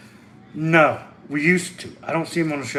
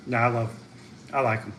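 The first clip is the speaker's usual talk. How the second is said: usual speaking voice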